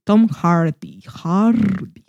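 breathy, lingering, lovestruck; dreamy swooning name. Shown as a demonstration. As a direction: sigh the name like a crush. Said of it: "lo pienso así".

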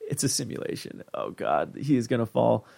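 hushed, breathy aside; whispered dread. Retold or imitated. Viewer whispering worry about Jerry at the screen. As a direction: whisper it like you can't bear to watch.

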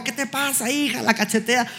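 high, outraged mother's voice; angry mother. Retold or imitated. An outraged mother scolding her daughter. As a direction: scold like a shocked mom. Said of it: "La mamá se enoja".

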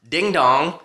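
slow spoken doorbell-style ring; two-note "ding dong" ring; imitated phone ring. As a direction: voice a phone ringing, drawn out. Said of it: "that's the way the phone sounds to me".

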